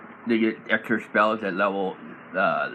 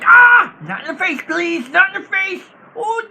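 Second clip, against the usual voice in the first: very high, loud, squealing mock plea; mock pleading; 7 dB louder than usual. Goblin bard faking fear to distract an enemy, squealing high plea. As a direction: beg like a coward. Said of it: "cutting words".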